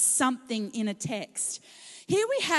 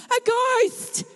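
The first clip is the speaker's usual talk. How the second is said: high, loud shriek of fright; terrified scream; 12 semitones higher, 7 dB louder than usual. Acted. Disciples seeing Jesus on the water, terrified scream. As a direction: scream it like you've seen a ghost. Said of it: "they were terrified and screamed".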